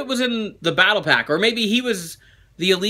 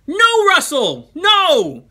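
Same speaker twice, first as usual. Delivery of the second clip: high, slow, drawn-out no; emphatic refusal; 9 semitones higher, 4 dB louder, much slower than usual. Real, not acted. Host refusing a viewer's shout out request, emphatic. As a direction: shut the fan down firmly, pitched up.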